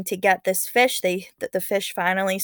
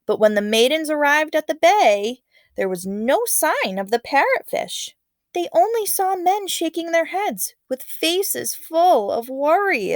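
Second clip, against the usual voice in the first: suspenseful narration; 8 semitones higher, 5 dB louder than usual. A narrator's reading. Teacher reading the tense moment, worried narration. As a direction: build the worry for the listeners.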